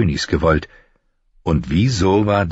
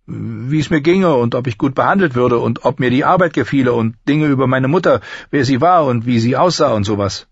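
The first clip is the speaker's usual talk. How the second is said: put-on higher-pitched young apprentice voice; high-voiced lad giving his account; apprentice boy answering his lord; 8 semitones higher, 3 dB louder than usual.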